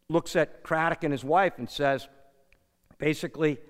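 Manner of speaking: ordinary talk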